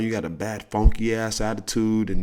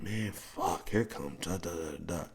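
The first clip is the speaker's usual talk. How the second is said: soft, dropped, dreading mutter; quiet dread at who's coming; groaning mutter; 4 semitones lower, 13 dB quieter, slower than usual. Retold or imitated. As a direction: grumble it under your breath. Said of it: "you're like".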